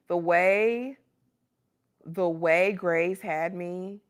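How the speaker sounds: "the way" loud and drawn out, with a pause before repeating; "the way Grey's had me" loud, slow and emphatic; emphatic raving. Real, not acted. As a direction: stretch "the way" slowly and loudly for emphasis. Raving about an old obsession.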